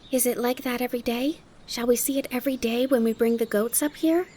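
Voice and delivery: high-pitched eager questions; eager child Heidi. Acted. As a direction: ask hopefully like a curious child. Voices Heidi asking.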